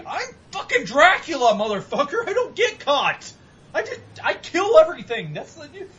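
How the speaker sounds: high, loud fake-screaming rant at the TV; mock screaming. Gamer yelling at the TV after getting caught, high mock scream. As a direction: rant at the screen like a sore loser, half-laughing. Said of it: "And I'm screaming at my T V".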